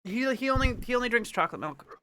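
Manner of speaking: usual speaking voice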